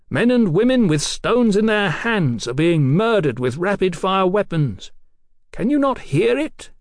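high, projected, impassioned appeal; coaxing appeal. A narrator's reading. Captain appeals to the doctor's conscience, coaxing. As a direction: declaim it to win over an enemy. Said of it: "in a new and coaxing voice".

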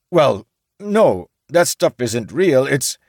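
flustered samurai. A narrator's reading. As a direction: samurai denying, cut off. Samurai denies again.

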